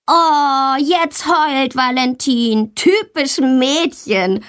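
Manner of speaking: put-on boy jeering at a crying classmate; mocking taunt. A narrator's reading. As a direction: drag out the teasing like a playground bully. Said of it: "ruft Tommy".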